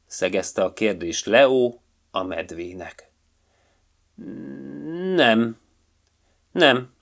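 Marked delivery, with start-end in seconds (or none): 5.1-6.9 firm. A narrator's reading. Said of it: "jelentette ki Borcsa határozottan".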